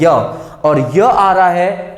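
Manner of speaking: य held slow and clear; drawn-out य sound. Shown as a demonstration. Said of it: "इसका sound य आता है".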